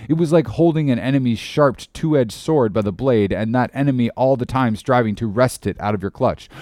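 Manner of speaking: ordinary delivery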